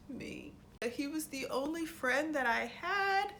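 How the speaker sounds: high-pitched, hammed-up tearful testimony; mock sobbing. Sansa pleading her case to the council, mock sobbing. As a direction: wail it like a badly faked cry. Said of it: "she's crying, she's sobbing, she's like".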